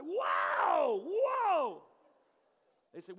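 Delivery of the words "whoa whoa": loud high alarmed whoas; high shocked whoa. Retold or imitated. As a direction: recoil in shock. Body set down beside him.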